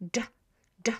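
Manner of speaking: "D" as a quick single dż; fuse "D" into one short dż; very quick, one sound. Shown as a demonstration. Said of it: "becomes one sound".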